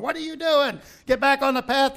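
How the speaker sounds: high, loud angry yelling at the animal; angry rider. Retold or imitated. Balaam yelling at his donkey, angry. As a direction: scold the stubborn donkey. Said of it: "Balaam's, like, on top of him going".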